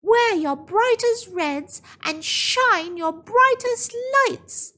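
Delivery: high, bright calling out; May telling the villagers her plan, eager high call; eager instruction. Acted. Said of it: "she said to the villagers".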